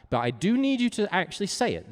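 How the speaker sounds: ordinary talk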